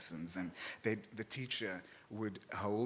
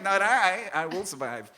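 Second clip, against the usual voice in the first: defiant camp; 7 semitones higher, 9 dB louder than usual. Retold or imitated. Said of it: "I was like".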